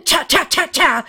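high, percussive bursts; voiced gunfire chatter; imitated rifle fire. A narrator's reading. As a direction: punch each cha like a shot.

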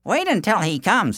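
villagers' voice, pitched high; helpful villagers. A narrator's reading. Villagers offer the fugitive help, high helpful voice. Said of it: "they said".

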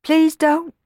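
put-on pleading boy. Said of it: "whimpered Sammy".

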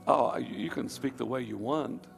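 ordinary delivery

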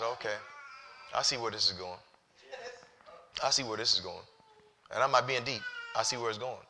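low, hushed resignation; subdued reply. Retold or imitated. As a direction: murmur it low, as it dawns on you. Preacher retells his subdued reply to God.

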